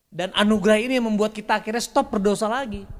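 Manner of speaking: ordinary delivery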